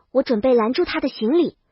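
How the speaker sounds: ordinary delivery, nothing marked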